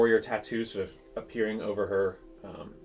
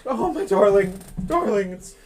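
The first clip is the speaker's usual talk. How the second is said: high, loud and laughing with joy; tearful delighted welcome, much higher and louder; overjoyed greeting; 13 semitones higher, 10 dB louder, slower than usual. Acted. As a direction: gush over a long-lost loved one, half laughing. Tiefling joyfully hugs returning friend.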